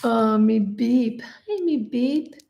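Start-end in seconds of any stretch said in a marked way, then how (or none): 1.2-2.4 higher, slow sweet pet talk; cooing to a cat. Real, not acted. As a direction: greet the kitty tenderly. Greeting the cat.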